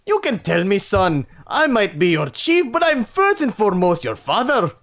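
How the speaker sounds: a try at a Scottish father; Scottish accent. Acted. Chief to his son as a father, attempted Scottish accent. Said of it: "give him a Scottish accent. I'll try".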